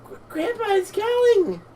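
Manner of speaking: put-on very high little-girl character voice; excited granddaughter. Child announcing that grandpa calls, high-pitched cry. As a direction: pipe up high, drawing out the last word.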